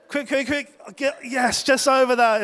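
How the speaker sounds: high, loud, breathless retold urging; frantic urging. Surfer reliving clearing the second wave, high and urgent. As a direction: push yourself over the wave, then relief.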